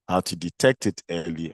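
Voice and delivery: ordinary delivery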